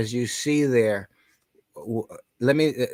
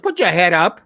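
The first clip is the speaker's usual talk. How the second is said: boss ordering; 4 semitones higher, 8 dB louder than usual. Retold or imitated. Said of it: "He goes".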